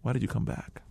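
quiet, tense voicing of the barber; hushed retold question. Barber holding a razor asks him quietly, hushed and tense.

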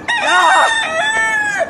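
very high, loud mock scream; startled scream. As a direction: shriek in exaggerated fright. Servant sees the army.

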